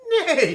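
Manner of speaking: high whinnying neigh; horse neigh. Acted. Horse sound in the story.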